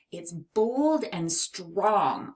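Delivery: ordinary talk, nothing marked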